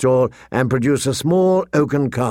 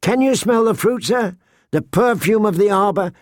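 high-pitched, lilting sales patter; coaxing wine seller; 6 semitones higher than usual. Acted. Seller urging the suspicious lord to taste, high coaxing voice. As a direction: press him to taste, pitched up and eager.

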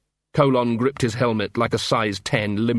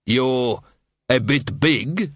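hesitant, halting dwarf; lame objection; much slower than usual. A narrator's reading. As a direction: offer a feeble excuse, slowly. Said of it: "he said lamely".

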